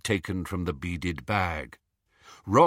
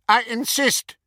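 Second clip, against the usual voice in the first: high, loud and pushy; aggressive; 14 semitones higher, 6 dB louder than usual. Acted. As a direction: force it on him, insisting. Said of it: "said Hermione aggressively".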